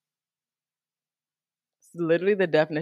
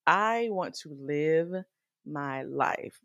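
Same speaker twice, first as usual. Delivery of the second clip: ordinary delivery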